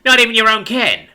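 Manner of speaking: loud, high, needling tirade; resentful crooked patrolman; fed-up pig cop. A narrator's reading. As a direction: taunt your bossy partner. Pig taunts Horace.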